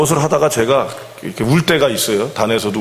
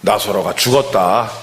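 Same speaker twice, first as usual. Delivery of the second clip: lower, slow, solemn announcement as Jesus; Jesus' voice; 4 semitones lower, slower than usual. Retold or imitated. Jesus telling the disciples, lower, slow and solemn. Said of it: "이렇게 얘기하니까 예수님이".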